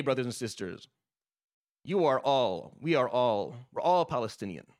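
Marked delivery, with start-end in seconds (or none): none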